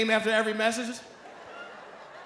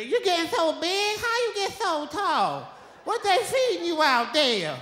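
put-on higher, slow, amazed old folks; grandparent voice; 7 semitones higher, slower than usual. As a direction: marvel like grandparents at the grown kid.

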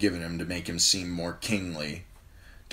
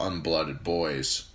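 plain reading voice

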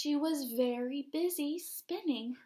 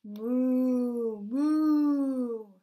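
cow mooing; 9 dB louder than usual. Acted. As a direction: moo like a big cow. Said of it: "said the cow".

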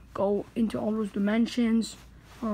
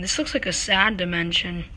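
ordinary talk

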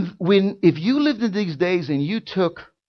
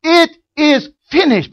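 It is finished cried high and loud; Jesus crying out from the cross, a powerful shout; 11 semitones higher, 10 dB louder than usual. Acted. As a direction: call out It is finished with full power. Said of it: "It was a powerful shout".

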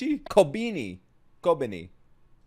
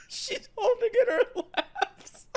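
high laughing through the words; cracking up, barely able to talk; wheezing laugh; shrieking laughter; 6 dB quieter, slower than usual. Real, not acted. Laughing fit at scene.

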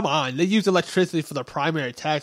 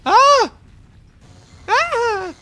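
2 sudden high yelp of pain; 3 shriek as the hit lands; 7 dB louder than usual. Real, not acted. 4 gamer taking a big hit, high pained shriek.

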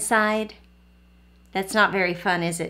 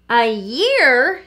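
shouted; 3 semitones higher, 6 dB louder than usual. Acted. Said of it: "shouted Tanya".

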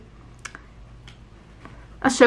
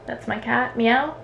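soft aside; 9 dB quieter, slower than usual. Real, not acted. Aside about cat.

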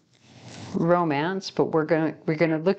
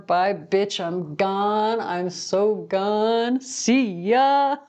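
drawn-out "gone", higher sing-out "See ya"; sassy farewell; 3 semitones higher, much slower than usual. Acted. Someone flipping you off out the door, sassy farewell. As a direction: playfully flip them off with your voice. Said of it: "Somebody wants to flip you off as they are leaving".

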